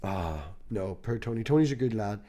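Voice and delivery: ordinary talk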